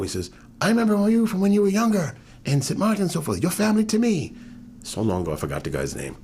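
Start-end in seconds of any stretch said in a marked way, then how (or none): 0.6-4.3 much higher and louder, eager; excited recognition. Retold or imitated. Young man in the pub recognizing him, eager and loud. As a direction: call it out as if spotting an old friend.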